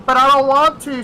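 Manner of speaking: high, whining refusal; whiny coworker. Retold or imitated. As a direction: complain like you won't budge.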